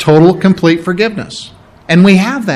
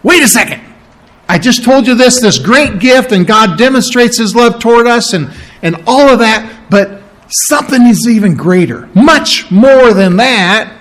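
higher-pitched insistent Paul, interrupting himself; emphatic apostle; 5 semitones higher than usual. Acted. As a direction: speak as Paul making an emphatic point, pitched up. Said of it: "it's like Paul saying".

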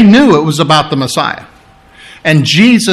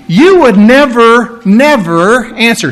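"never, never" pushed higher, slower and louder; stress on the two nevers; 5 semitones higher, 3 dB louder, slower than usual. Shown as a demonstration. Jesus to the high priest, hitting "never, never" high and slow. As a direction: drive each "never" home. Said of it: "two nevers".

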